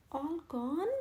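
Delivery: rising puzzled question. A narrator's reading. Henry hears the grocery money is spent, puzzled. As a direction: echo it in confusion. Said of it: "Henry said, puzzled".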